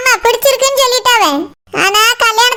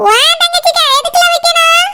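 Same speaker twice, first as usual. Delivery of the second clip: higher, scornful retort; 6 semitones higher than usual. Acted. Elder woman dismisses Saroja's sister, scornful. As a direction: brush her off sharply.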